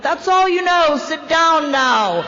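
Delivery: loud, high and stern, then a slow "Sit down now"; stern scolding. Retold or imitated. Sponsor ordering angry newcomer back to her seat, stern and loud. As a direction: bark the order and draw out the last part.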